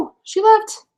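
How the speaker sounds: sudden high startled cry; startled when the caller disconnects. Real, not acted. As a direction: jolt up in pitch as she drops out. Caller's stream suddenly drops out.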